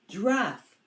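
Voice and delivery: lightly hammed excited guess. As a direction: blurt out the answer. Boys guess animal.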